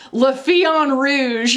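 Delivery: "La Fille en Rouge" said with an American mouth trying too hard at French; overdone American-French. Shown as a demonstration. Vlogger mocking her own overdone French on "La Fille en Rouge". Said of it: "my American muscles in my mouth will always try and say".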